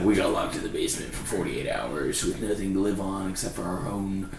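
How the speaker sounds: low, soft, offhand mimicry; deadpan retelling. Friend mimics frat boys' horror stories, deadpan. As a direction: drop low and casual, as if it were normal.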